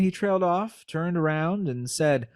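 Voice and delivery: normal talking voice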